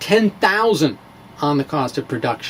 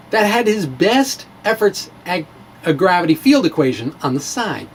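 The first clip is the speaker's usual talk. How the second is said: ordinary talk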